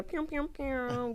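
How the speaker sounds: mouthed laser-style "pew" noises; imitated sound effect. Shown as a demonstration. Host demonstrating the sound drops she wants, playful imitated effect. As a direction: make playful drop noises with your voice.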